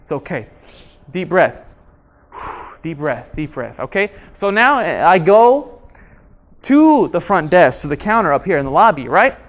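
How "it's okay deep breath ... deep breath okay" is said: soft, low self-soothing; calming self-talk. Acted. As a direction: talk yourself down with deep breaths. Acts out calming himself down.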